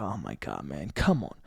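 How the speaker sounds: sinks low and quiet, groaning in defeat; a deflated mutter under the breath. Retold or imitated. Trader groaning at a losing trade, low and deflated. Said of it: "I'm like".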